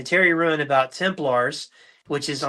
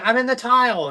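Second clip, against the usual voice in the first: high put-on woman's voice calling from nowhere; lady's voice; 8 semitones higher than usual. Comedian pranking store staff in a lady's voice. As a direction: throw a lady's voice, pitched up. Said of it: "he does this lady's voice".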